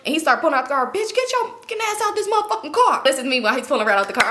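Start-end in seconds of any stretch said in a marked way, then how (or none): 0.9-3.0 high-pitched mock scolding; angry order. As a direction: order her out, acting outraged. Acted man pulling Rat from car.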